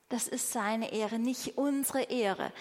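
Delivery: normal talking voice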